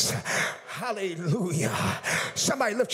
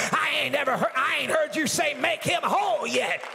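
preaching at full force; 9 semitones higher than usual. Acted. Pastor calling out broken people, full-force preaching shout. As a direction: drive it home, shouting to the congregation.

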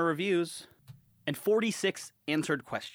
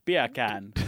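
lower-pitched mock Jamaican echo; Jamaican accent; 5 semitones lower than usual. Shown as a demonstration. Trying the beer can trick after co-host, Jamaican accent. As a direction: try out a put-on Jamaican accent. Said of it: "beer can with a Jamaican accent".

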